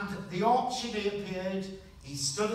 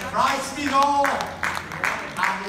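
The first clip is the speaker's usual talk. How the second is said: loud, higher preacher's cry; preaching at full force; 3 semitones higher, 9 dB louder, much slower than usual. Real, not acted. Preacher proclaims Christ to the congregation, loud and higher. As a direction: proclaim it to the whole hall. Preacher drives home the point.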